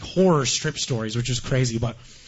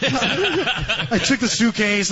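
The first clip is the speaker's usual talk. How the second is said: "I took the suitcase" said slowly through a laugh, higher; 6 semitones higher, much slower than usual. Real, not acted.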